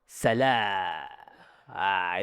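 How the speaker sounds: long, low open "Salah" trailing into "Ah"; free voice demo. Shown as a demonstration. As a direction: release the vowel of "Salah" and hold it. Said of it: "itu suara".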